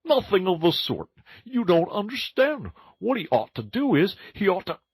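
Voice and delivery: very high, pressing animal voice; Mole, insistent. Acted. Mole overrides Rat at the table, very high and insistent. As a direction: talk over the others, high and eager.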